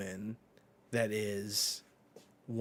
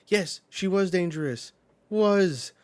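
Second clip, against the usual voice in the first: high, loud emphatic cry; a loud, high, insistent protest; 9 semitones higher, 11 dB louder than usual. Retold or imitated. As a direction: hammer home the word was. Brook insisting in retold scene.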